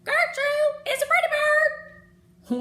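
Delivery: high, loud screechy bird squawk; screechy parrot voice. A narrator's reading. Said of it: "a real screechy voice said".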